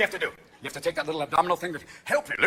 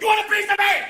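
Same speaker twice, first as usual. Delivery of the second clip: high, loud threatening shout; pugnacious hollered dare; belligerent challenge; 12 semitones higher, 6 dB louder than usual. Acted. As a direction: yell it like someone brandishing a bat. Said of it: "with a baseball bat going".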